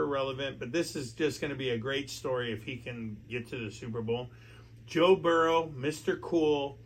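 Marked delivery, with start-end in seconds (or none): none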